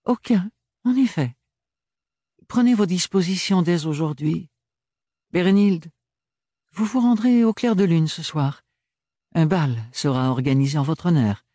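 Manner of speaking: high, breezy courtesy; winning with a smile; triumphant Archibald. Acted. Archibald conclut.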